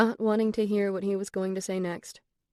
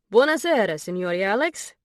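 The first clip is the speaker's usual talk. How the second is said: put-on loud, delighted Italian accent, pitched up on Buonasera; thick Italian accent; 2 semitones higher, 6 dB louder than usual. A narrator's reading. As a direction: greet him like an Italian host with a thick Italian accent. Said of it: "he exclaimed in a thick Italian accent".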